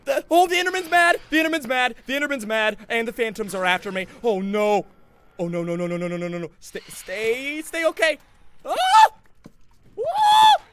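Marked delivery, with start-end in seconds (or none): 0.1-4.9 high, loud alarmed cries; panicked shouting. Real, not acted. Angry Enderman chases him. 8.7-10.6 very high, very loud shriek; terrified scream. Real, not acted. Enderman attacks.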